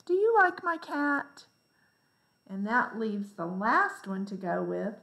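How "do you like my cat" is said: "Do you like my cat?" with you pitched high and loud; a clear, lifted teacher's question; question intonation. Shown as a demonstration. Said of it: "So that gets the question mark".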